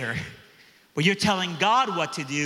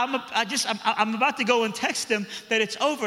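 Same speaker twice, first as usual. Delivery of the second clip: put-on higher girlish voice, rambling and eager; young girl voice; 7 semitones higher than usual. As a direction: play the teen girl stumbling over her words. Preacher voices the girl confessing.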